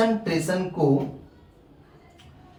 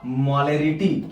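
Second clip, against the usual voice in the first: drawn-out answer; 6 semitones lower, much slower than usual. Shown as a demonstration. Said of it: "क्या लेना होगा?".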